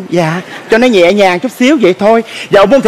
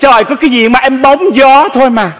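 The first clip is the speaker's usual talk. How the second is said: usual speaking voice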